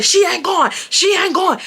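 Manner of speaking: imitating a yelling boyfriend; retold yelling. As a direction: shout it like he did on the phone. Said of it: "he was yelling".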